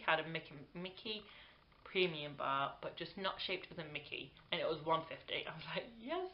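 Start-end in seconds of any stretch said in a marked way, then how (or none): 6.0-6.3 high-pitched mock squeal of delight; delighted yes. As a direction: let a retold yes leap up high. Retold delight at cheap Mickey bar.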